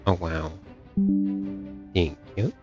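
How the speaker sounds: normal talking voice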